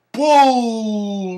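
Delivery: long, high, loud drawn-out cry; exasperated shout. Real, not acted. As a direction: stretch both syllables out in loud disgust. Angry rant at bad school advice.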